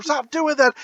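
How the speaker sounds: high-pitched mock protest; Mister Green. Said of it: "he's like".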